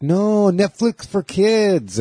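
put-on high, slow kid's insistence; child's insistent voice. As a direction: drag out Netflix for Kids like a stubborn kid. Godson quoted insisting.

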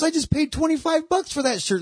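high, squeaky indignant protest; indignant guy. Retold or imitated. Guy protesting he paid for the shirt, high squeaky whine.

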